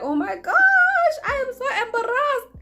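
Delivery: squealing embarrassment. Retold or imitated. Girl standing in the grade eight line, squealing embarrassed. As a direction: squeal it out, mortified but giggling. Named in front of everyone.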